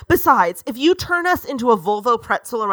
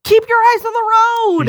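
louder, higher shout; 10 semitones higher, 6 dB louder, faster than usual. Acted. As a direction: yell it in fear at the driver. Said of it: "She shouted".

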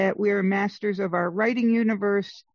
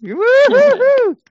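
high, loud whooping cheer; 10 dB louder, slower than usual. Real, not acted. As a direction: whoop it up like a fan. Celebrating a correct answer.

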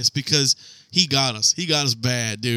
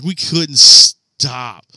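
ordinary delivery

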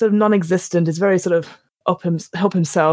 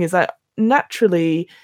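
normal talking voice

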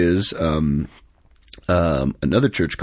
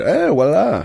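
mock Italian flourish; 10 semitones higher, slower than usual. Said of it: "they said".

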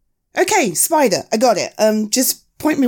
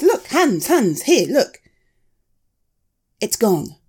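ordinary talk

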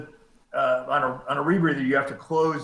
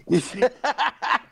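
high laugh; 9 semitones higher than usual. Real, not acted. Laughing at his own answer.

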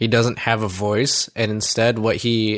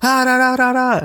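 high, loud mock yell; inner voice yell demo; 14 semitones higher, 4 dB louder than usual. Shown as a demonstration. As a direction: belt out the nonsense syllables up high. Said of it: "I'm going like".

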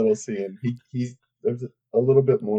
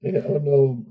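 ordinary delivery

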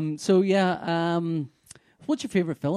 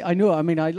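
ordinary delivery

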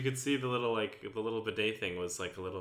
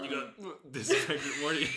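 slow, higher, laughing Rick voice; Rick Sanchez impression; 7 semitones higher than usual. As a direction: slur it like Rick, half laughing. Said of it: "Rick from Rick and Morty".

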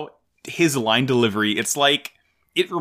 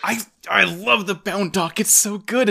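drawn-out, high adoring coo; gushing; 8 semitones higher than usual. Real, not acted. Fan gushing to cohost about the Bound Dock, cooing high. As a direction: swoon over a favourite robot. Gushing over favorite suit.

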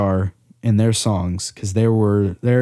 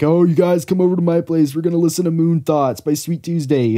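excited party host; 6 semitones higher than usual. Retold or imitated. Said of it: "being like".

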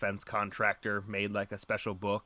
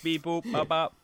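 beeping robot, higher; 6 semitones higher than usual. Acted. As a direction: chirp it like a toy robot. Robot noises after robot bit.

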